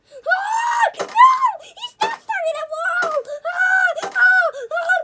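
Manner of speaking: high, loud screams of panic; terrified screaming. Real, not acted. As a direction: scream as you're caught. Caught by the killer.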